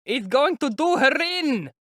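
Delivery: high, loud, slow horrified shout; alarmed shout. A narrator's reading. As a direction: cry out in horror from the crowd.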